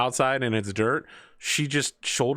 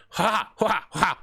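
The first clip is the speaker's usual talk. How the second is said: short, high mock fighting cries; imitated martial-arts shouts; 8 semitones higher, slower than usual. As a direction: punch out playful kick noises. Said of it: "she like".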